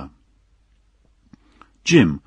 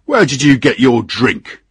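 loud, raised-pitch accusation; angry shout; 5 semitones higher, 8 dB louder than usual. Acted. Captain accusing the engineer of drinking, shouting angrily. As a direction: demand it as a furious captain. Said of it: "shouted the captain".

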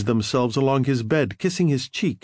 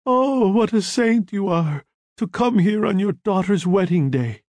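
wailing sob; 7 semitones higher than usual. A narrator's reading. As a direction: wail it like a grieving mother. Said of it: "sobbing, wailing".

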